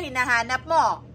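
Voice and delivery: ordinary talk, nothing marked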